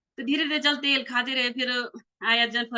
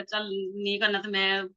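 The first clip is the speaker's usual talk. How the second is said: ordinary talk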